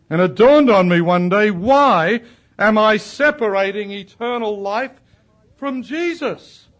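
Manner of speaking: full-voiced revival preaching; preaching at full force. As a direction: proclaim it to the whole congregation. Climax of the sermon.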